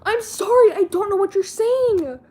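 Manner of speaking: high, loud mock scolding that cracks into laughter; mom yelling. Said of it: "her mom would be like".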